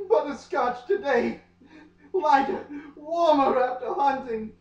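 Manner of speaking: high, gasping swoon. Acted. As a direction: gush over his eyes, breathless. Swooning over his eyes.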